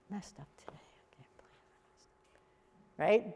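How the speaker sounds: barely audible whisper; very low volume. Shown as a demonstration. Coach showing volume with an admitted mistake, whispered. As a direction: whisper it, slowing down, dejected admission. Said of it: "Just the volume alone".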